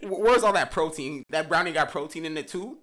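loud disbelieving question; incredulous. Real, not acted. Mocking protein brownie.

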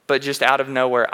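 usual speaking voice

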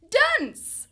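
booming, high cry of Dance; shouted command. A narrator's reading. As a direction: proclaim it like a revelation. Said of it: "He said".

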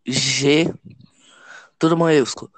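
normal talking voice

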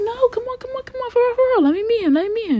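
high-pitched, playful begging; pleading. Retold or imitated. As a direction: beg a friend in a high voice. Begging friend for an introduction.

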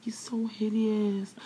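slow, airy whisper; hushed disgust. Real, not acted. Friend gossiping about an ugly YouTuber, hushed disgust. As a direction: drag it out in a high whisper. Dwelling on his ugliness in disgust.

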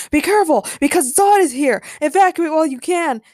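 high, loud superhero warning; sudden shout. A narrator's reading. Superman warning Jane that Zod is here, shouting. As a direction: shout an urgent alarm in character. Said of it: "Superman suddenly shouted".

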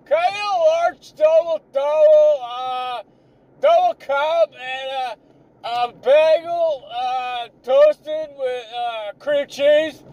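high, slow, nasal-free put-on customer voice shouting an order; mock yelling customer. Barista imitating a customer yelling into the drive-thru speaker, high and slow. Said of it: "when they yell at you".

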